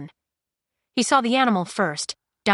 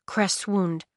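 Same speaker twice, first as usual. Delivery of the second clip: plain reading voice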